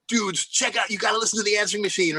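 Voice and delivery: excited roommate. Retold or imitated. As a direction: roommate rushing to share the message.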